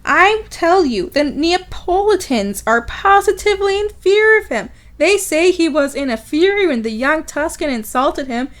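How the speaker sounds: high, theatrical gossip voice; gossiping townsperson. Acted. Townsperson gossiping about Tonio, theatrically scandalised. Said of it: "got direct quote of the gossip".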